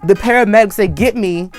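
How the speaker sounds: ordinary delivery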